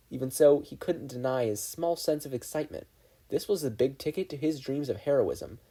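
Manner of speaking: ordinary delivery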